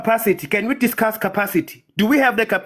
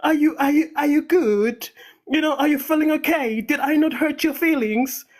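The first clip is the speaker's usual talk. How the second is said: lightly raised, mock-caring voice; mock concern; 6 semitones higher than usual. Mocking men who need their emotions nursed, mock-caring. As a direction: pretend to fuss over a grown man's feelings.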